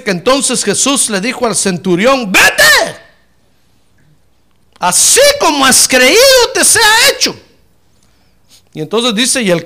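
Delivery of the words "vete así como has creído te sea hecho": very high, loud, slow voice of Jesus granting the request; Jesus telling the centurion to go, loud and high; Jesus speaking to centurion. Acted. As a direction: declare it solemnly and grandly.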